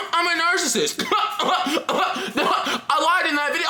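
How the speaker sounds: high-pitched, cracking up; laughing confession. Real, not acted. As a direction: confess it through real laughter. Laughing confession about own type.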